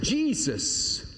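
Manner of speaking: startled outburst, half cry; blurted out. Retold or imitated. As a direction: let it burst out before you can stop it. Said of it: "I just blurted out".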